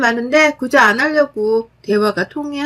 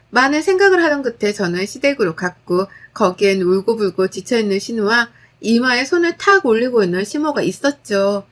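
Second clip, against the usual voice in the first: ordinary delivery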